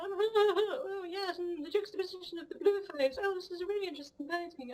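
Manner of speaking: high, affected gallery chatter; pretentious art snob. Retold or imitated. Mocking pretentious visitors at an exhibition, affected high voice. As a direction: camp it up like a pompous art critic. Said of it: "pretentious wankers at exhibitions".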